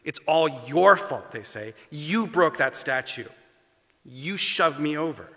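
put-on loud, slow, accusing kid; angry accusation. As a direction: jab every word with blame. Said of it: "pointing at you angrily".

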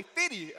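loud, high "fifty." as "fiddy"; Saint Louis fifty. Retold or imitated. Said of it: "I say".

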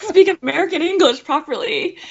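high, hammed-up complaint; mock helplessness. Retelling being bullied over her accent, mock helplessness. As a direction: act out a helpless high-pitched protest.

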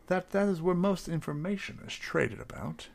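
ordinary talk, nothing marked

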